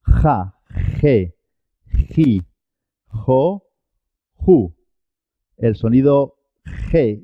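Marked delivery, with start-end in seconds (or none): 0.0-4.7 "Ja, je, ji, jo, ju" each with a throaty rasped j; guttural j. Shown as a demonstration. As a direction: drill "Ja, je, ji, jo, ju" one by one from the throat. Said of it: "el gutural".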